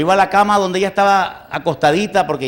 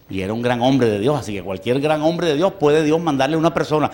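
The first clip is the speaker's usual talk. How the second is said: ordinary talk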